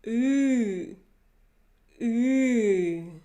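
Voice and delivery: ü pushed to the back of the head; ü with pencil in teeth. Shown as a demonstration. Teacher shows the ü sound for English speakers. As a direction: say ü through teeth held apart. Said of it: "a pencil or a stick in between your teeth".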